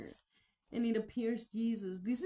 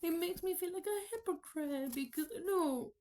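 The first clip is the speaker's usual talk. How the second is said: crying; 5 semitones higher than usual. Real, not acted. Confessing to viewers she felt like a hypocrite, crying. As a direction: speak through tears. Says it makes her sad.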